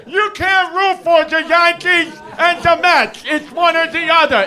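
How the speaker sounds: mock the know-it-all fans. Mocking fans who tell him he can't root for both, loud sneer. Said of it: "what I wanna say to people".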